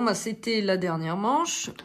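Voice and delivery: ordinary talk, nothing marked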